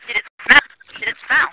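loud, teasing question. Real, not acted. Gamer teasing her teammate stuck in a crack. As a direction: shout the joke across.

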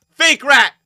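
very high, very loud insult; all-out taunting yell; screamed jeer. Real, not acted. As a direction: scream it at the opponent. Insult repeated harder.

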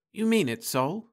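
put-on high, wondering young woman; Florence's voice. A narrator's reading. Wife hearing a proposal, high and wondering. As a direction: ask it in a high, stunned voice.

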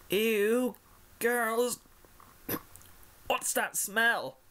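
high, slow, sleepy squeak. Acted. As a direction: squeak it out half asleep. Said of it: "she squeaked".

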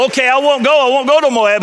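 high, loud, hurried backing down; a scared man giving in at once; panicked promise, voice up high; frightened Balaam. Acted. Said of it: "So Balaam says to the angel".

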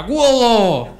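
high, loud goal yell; old man shouting. Retold or imitated. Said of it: "um velho a gritar".

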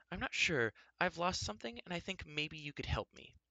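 high, hesitant nervous caller; unsure wife asking a wizard for help; nervous woman. A narrator's reading.

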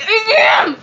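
hammed-up loud high yell; high, defiant mock shout; shouted retort. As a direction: yell it back at the critics. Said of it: "and I'm like".

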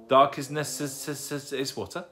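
slow, halting try at a long word, stuttering on the s; stumbling. Acted. Young owl attempting the little girl's new word, stumbling. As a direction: get stuck on "necess-", then give up puzzled.

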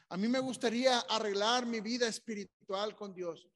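loud, high preaching plea; impassioned altar call. Acted. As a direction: cry it out on behalf of the seeker.

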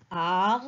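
"ar" held as a separate syllable; syllable sounded alone. Shown as a demonstration. Teacher shows children the first syllable, "ar" sounded alone. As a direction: isolate "ar" and stretch it. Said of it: "la première syllabe c'est ar".

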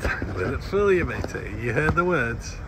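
ordinary talk

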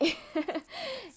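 sudden high burst of laughter; embarrassed laugh about English. Real, not acted. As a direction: laugh out at yourself, high and loud.

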